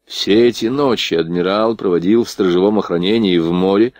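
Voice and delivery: plain reading voice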